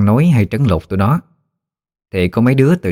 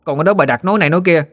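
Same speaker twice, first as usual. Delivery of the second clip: put-on higher, emboldened kid's voice; acted tattling child standing up boldly; defiant boy; 6 semitones higher than usual. A narrator's reading. Timid boy accusing the bully, higher and defiant. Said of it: "Nó nghinh nghinh mặt lên".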